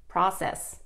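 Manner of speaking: process with short O, "PRAH-cess"; American process. Shown as a demonstration. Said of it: "It's the American way of saying it".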